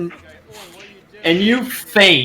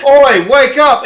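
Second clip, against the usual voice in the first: shouting; 7 semitones higher, 6 dB louder than usual. Acted. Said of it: "This guy's".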